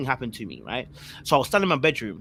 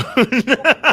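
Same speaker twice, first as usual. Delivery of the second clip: high burst of laughter; loud laugh; 10 semitones higher, 10 dB louder than usual. Real, not acted. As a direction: crack up loudly. Laughs at own story.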